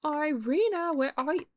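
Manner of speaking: high, loud call across the house. Real, not acted. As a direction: call her name from afar. Calling the maid through house.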